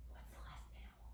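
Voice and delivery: hushed secret question; playful whisper to the children. Acted. Whispered aside to kids.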